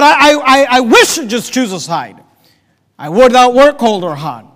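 loud, stammering exasperation; frustrated. Acted. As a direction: burst out as if fed up. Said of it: "Can you sense the spiritual frustration".

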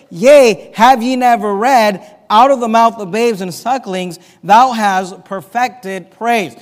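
loud, ringing proclamation of the verse; proclaiming Jesus. Retold or imitated. Preacher voicing Jesus answering the priests, loud and ringing. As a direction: call it out like a rebuke from the temple. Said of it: "Jesus responds to that is this".

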